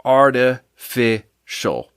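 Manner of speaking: "Artificial" very slowly, split into separate chunks; a slow, chopped-up "Artificial"; syllable by syllable. Shown as a demonstration. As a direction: count out "Artificial" with gaps between syllables. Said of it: "Three syllables".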